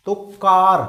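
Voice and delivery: कार said loud and firm with a long aa; emphatic demo word. Shown as a demonstration. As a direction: punch कार for the class. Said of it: "C A R".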